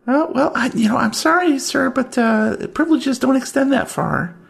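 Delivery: high chirpy desk clerk; Tracy voice. Acted. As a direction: pitch way up for the clerk.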